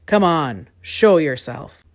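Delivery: loud rumbling command, slowing at the end; big, deep monster summons; deep rumble. A narrator's reading. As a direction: rumble it slowly like a huge creature. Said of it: "rumbled a very deep voice".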